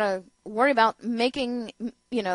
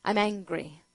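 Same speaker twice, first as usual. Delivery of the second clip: low, subdued mocking quote; understated sarcastic impersonation; mock anger, deadpan; 6 semitones lower, 6 dB quieter than usual. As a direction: imitate anger with no force behind it. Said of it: "watch Paul Ryan get angry".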